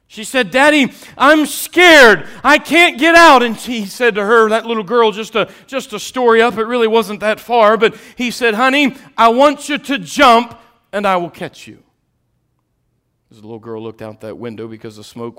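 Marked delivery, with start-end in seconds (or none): 0.1-0.5 high, loud preaching of the fire story; preaching at full force. Acted. Preacher telling the fire story loudly to the congregation. 0.5-3.4 high, loud frightened cry, slow at first; scared child calling out. Acted. Girl trapped by fire calling down to her dad, frightened. 3.4-11.8 high, loud preaching of the fire story; preaching at full force. Acted. Preacher telling the fire story loudly to the congregation.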